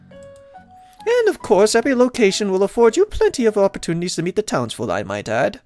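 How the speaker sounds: high-pitched, welcoming lady mayor voice; pitched well up, pleased to see the newcomer; cheerful mayor. Acted. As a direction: voice a delighted mayor greeting a newcomer.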